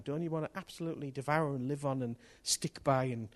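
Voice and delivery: usual speaking voice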